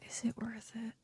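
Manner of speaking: whispering. Real, not acted. Muttering to self while deciding.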